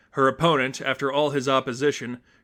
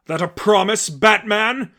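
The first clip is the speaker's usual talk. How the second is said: higher, louder sneering question; mocking taunt; 8 semitones higher, 7 dB louder than usual. Seraphim taunts Batman's threat, high and mocking. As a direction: toss it back with scorn.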